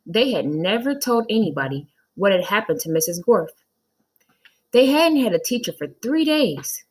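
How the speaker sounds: put-on reading aloud to children; lively read-aloud storytelling; story narration. A narrator's reading. Teacher reading the chapter to her class, animated.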